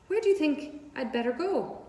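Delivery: a little pig lost in the big world; unsure young pig. Acted.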